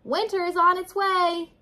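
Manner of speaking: high, loud announcement; calling out. A narrator's reading. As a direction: call the news up to someone perched high. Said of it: "called Woodchuck".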